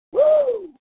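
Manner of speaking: a sudden high whoop. Acted. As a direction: whoop like at a rodeo. Cheering the tractor gag.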